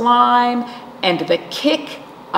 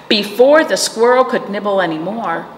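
ordinary delivery, nothing marked

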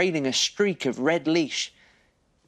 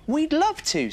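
put-on higher, friendly little girl voice; higher voice for the girl on the swings. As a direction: greet him like a small girl. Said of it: "said Ruby".

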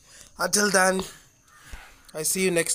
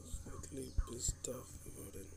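hushed, unvoiced confession; whisper; 5 semitones lower, 16 dB quieter than usual. Real, not acted. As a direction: whisper it as a guilty aside. Aside after apologizing to viewers.

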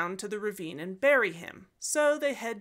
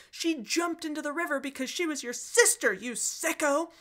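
livid scream; 6 semitones higher than usual. Acted. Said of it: "livid, screaming".

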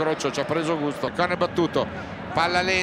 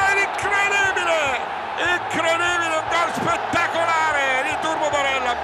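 breathless italian goal commentary; 8 semitones higher than usual. Real, not acted. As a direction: erupt and chant the goal over and over. Inter goal, commentator erupts.

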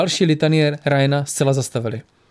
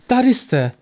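higher-pitched sigh of relief; relieved; 9 semitones higher than usual. A narrator's reading. Lisbeth finds her friends in the alley, relieved. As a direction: let relief lift the pitch. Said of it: "vydechla Lisbeth s úlevou".

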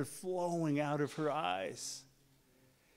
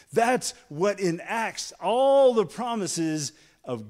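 loud, higher preaching build; full-force preaching; 6 semitones higher, 10 dB louder than usual. Acted. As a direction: drive it home to the congregation.

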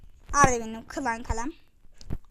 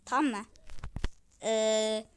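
normal talking voice

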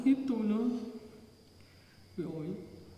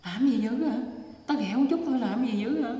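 mock angry retort; 3 semitones higher, 5 dB louder than usual. Drunk harasser brushing off a mother's plea, retold angrily. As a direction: snap back louder, as the retold harasser.

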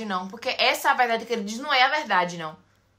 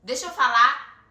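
louder and slower, demanding the floor; insisting on a turn; 8 dB louder, slower than usual. Real, not acted. [name] cutting Kaique off to speak, louder and slower. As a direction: say it slowly and firmly to get heard.

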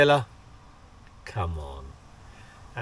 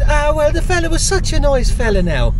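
higher, louder Irish voice imitating his mum; Irish accent; 9 semitones higher, 6 dB louder than usual. Son retelling mum's car boot tale, imitated Irish accent. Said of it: "doing an Irish accent".

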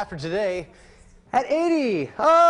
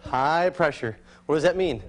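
ordinary talk

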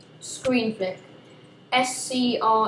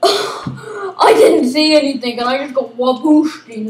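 slow, loud whiny complaint; whiny mock cry; 9 dB louder than usual. Real, not acted. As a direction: moan about being ambushed. Whining after ambush.

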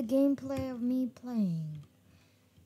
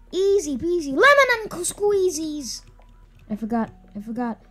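ordinary talk, nothing marked

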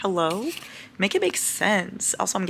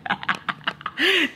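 a burst of high giggling; high delighted laugh; 10 semitones higher than usual. Real, not acted. Showing her cat in his new bed, high delighted laugh.

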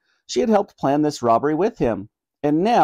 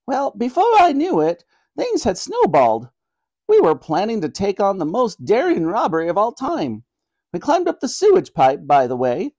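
high-pitched, lively grandmother voice; old granny explaining herself to royalty; confessing granny; 6 semitones higher than usual. Acted. Granny recounting the robbery to the Queen, apologetically.

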